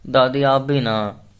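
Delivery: sulky drawn-out protest with a pout; embarrassed granddaughter pouting at grandma's teasing. A narrator's reading. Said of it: "मुंह बनाते हुए बोली".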